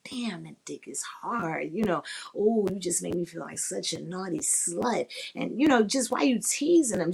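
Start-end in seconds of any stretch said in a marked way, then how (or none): 0.1-1.7 slow, soft, low sultry murmur; slow dirty talk. Shown as a demonstration. Demonstrating dirty talk lines. 2.4-5.1 soft, lightly sultry moan-like line; a hint of bedroom tease; sultry dirty talk. Shown as a demonstration. Demonstrating dirty talk lines.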